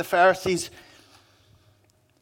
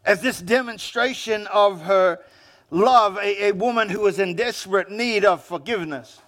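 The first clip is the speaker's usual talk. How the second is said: normal talking voice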